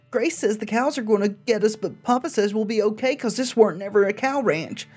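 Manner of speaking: put-on high, childlike diary reading; little girl voice. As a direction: read it like a scared little girl.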